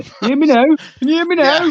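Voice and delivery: high-pitched mocking imitation; Max and Paddy. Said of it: "Sound like Max and Paddy".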